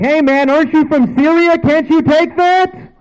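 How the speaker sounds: loud, very high jeering yell; mock taunting shout. Taunting someone shot from Syria, hammed-up high shout. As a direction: exaggerate the taunt, shouting high, dragging out the end.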